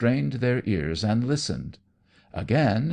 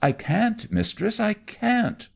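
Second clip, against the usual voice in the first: much higher pleading cry; desperate protest; 14 semitones higher than usual. Acted. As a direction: beg her to understand, pitch up. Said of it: "the man expostulated".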